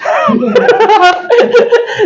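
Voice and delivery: short high laugh at his own parody; amused laugh. Real, not acted. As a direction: laugh at how silly it sounds.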